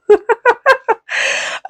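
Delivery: short high giggle; a quick breathy laugh. Real, not acted. Laughs at own remark.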